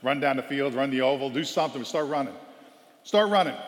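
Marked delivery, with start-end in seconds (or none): 3.0-3.5 louder, higher barked order; coach command. Retold or imitated. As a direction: bark the order sharply. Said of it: "Favorite thing Coach says".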